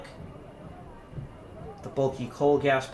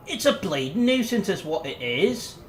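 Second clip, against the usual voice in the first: grumbling Yorkshire artificer, higher and slow; a Yorkshire mechanic grumbling at a machine; thick Yorkshire accent; 7 semitones higher, 3 dB louder than usual. Acted. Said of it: "grumbled in a thick Yorkshire accent".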